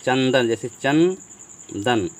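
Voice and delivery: चंदन said slowly with a clear न sound before द; nasal n shown. Shown as a demonstration. Teacher showing the class how चंदन sounds, slow. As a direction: drag out चंदन so the न is heard. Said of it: "यहाँ पर यह होगा चन".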